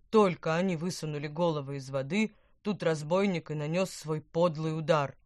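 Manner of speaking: odd bubbling fish voice, lightly done; gurgling fish voice. Acted. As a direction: bubble the words out like an old fish. (булькающий голос старой рыбы)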